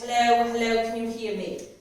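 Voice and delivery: loud call to check response; shouting to a casualty. Shown as a demonstration. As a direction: call out firmly to wake them. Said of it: "we're just gonna shout at them first".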